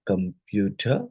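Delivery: "Computer" slowly split into syllables. Shown as a demonstration. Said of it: "more than one".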